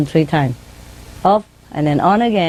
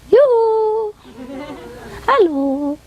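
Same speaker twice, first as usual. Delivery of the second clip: high, drawn-out greeting call; parrot calling hello; much slower than usual. Retold or imitated. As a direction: hoot a high greeting as the bird. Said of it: "she say".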